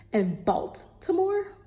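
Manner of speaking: "Baltimore" slowly with a careful, hard T; T put in. Shown as a demonstration. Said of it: "to put the T".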